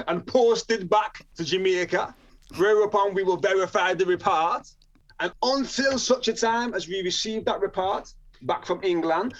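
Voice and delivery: formal officialese in a put-on Jamaican accent; awful Jamaican accent. Probation officer explains the juvenile report to magistrate, mock Jamaican accent. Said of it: "my Jamaican accent's awful".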